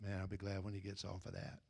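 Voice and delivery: quick, low, very soft aside; imitated listener's grumble. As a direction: toss it off under your breath, imitating a listener. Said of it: "He said".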